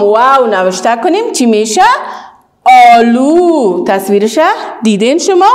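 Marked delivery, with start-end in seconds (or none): none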